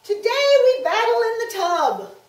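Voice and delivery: high, loud royal proclamation; stagey fake archaic declaiming. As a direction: proclaim like a jolly king. King voice in story.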